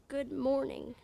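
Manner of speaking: usual speaking voice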